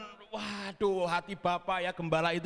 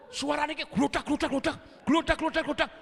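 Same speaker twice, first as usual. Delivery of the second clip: gludak gludak rattled out, rising higher and louder; imitated machine noise; 9 semitones higher, 3 dB louder than usual. Pastor imitating the ATM's rattle for the congregation. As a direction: copy the clatter of the cash machine.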